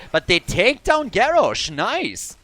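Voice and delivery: loud, high shoutcaster burst; real play-by-play excitement; excited kill call. Real, not acted. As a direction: jump on the kill with delight. Caster reacts to a sudden kill.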